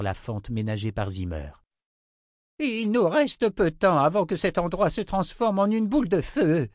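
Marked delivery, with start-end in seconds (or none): 2.6-6.7 high, loud, urgent warning; alarmed old wizard. Acted. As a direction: warn them that time is short.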